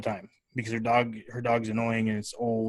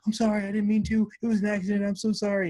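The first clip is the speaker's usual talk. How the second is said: higher mock-apologetic kid voice; imitating pleading son; 7 semitones higher, faster than usual. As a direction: whine out a scared kid's apology. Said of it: "And he's like".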